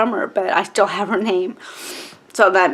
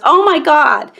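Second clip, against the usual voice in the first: loud, high gasp of recognition; astonished; 7 semitones higher, 7 dB louder, slower than usual. Retold or imitated. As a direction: react in amazement, higher and louder. Birth sibling's astonished reaction retold.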